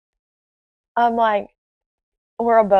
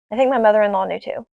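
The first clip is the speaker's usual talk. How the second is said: ordinary talk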